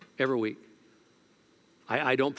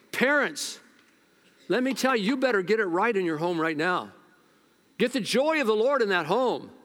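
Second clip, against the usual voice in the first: high, loud preacher's exhortation; fiery preaching; 6 semitones higher, 3 dB louder than usual. Acted. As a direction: preach it full force to the parents.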